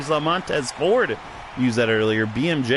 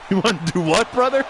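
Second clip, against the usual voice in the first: Hulk Hogan impression; 6 semitones higher than usual. Wrestler catchphrase voice.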